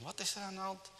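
a hint of Hanni, asking what's wrong; lightly voiced questioning boy. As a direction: ask it high, lightly in character. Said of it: "die vroeg van".

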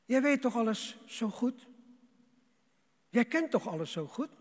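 pointed rhetorical jab; mock reproach. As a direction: challenge the know-it-all with rising questions. Reproach voiced as Jesus to Peter.

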